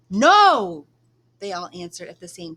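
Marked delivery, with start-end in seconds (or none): 0.1-0.9 loud emphatic shout. Acted. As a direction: cut her fear off with a shouted no. All answer at once.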